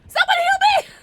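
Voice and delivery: very high, loud shriek; screaming for help. Real, not acted. Player begs teammates for healing, screaming for help. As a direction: scream out for rescue.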